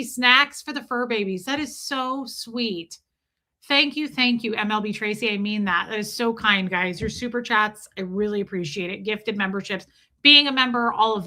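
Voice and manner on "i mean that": normal talking voice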